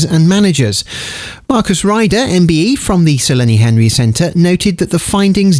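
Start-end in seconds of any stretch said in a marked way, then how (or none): none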